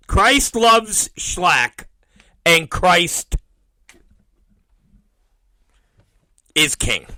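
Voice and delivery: slow, loud, drawn-out proclamation; slogan sign-off. Acted. Host signing off to break, slow loud motto. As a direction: intone the show's motto before the break.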